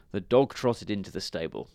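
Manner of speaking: usual speaking voice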